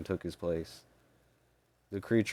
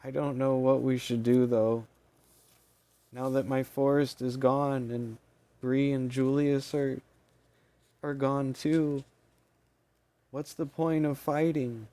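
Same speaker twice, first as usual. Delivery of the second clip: hopeless squeaky fairy; 7 semitones higher than usual. Acted.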